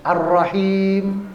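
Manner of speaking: Ar-Rahim with a long held r; doubled r. Shown as a demonstration. Teacher giving a tasdid example, slow doubled r in Ar-Rahim. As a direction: slowly stretch Ar-Rahim, doubling the r. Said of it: "ro-nya ada tasdidnya".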